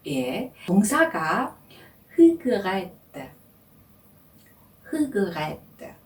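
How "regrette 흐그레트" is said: "regrette" with a throaty gargled R; French R. Shown as a demonstration. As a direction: start "regrette" from the back of the throat. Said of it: "이렇게 발음하시면 되고요". (목구멍 안쪽 가글 소리로 시작하는 "regrette")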